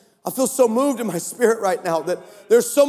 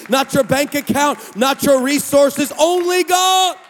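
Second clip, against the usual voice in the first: rising preacher shout; 4 semitones higher than usual. Acted. Preacher to church, building to a shout. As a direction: cry it out building to the last words.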